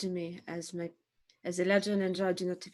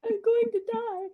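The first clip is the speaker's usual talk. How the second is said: laughing; 14 semitones higher than usual. Real, not acted. Laughing at the joke.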